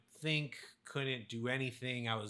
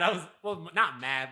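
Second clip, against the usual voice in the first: usual speaking voice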